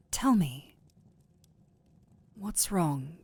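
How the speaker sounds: urgent whispered request; hushed plea. Acted. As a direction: breathe it, pleading gently.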